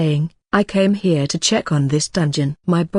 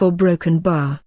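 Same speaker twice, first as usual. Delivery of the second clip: plain reading voice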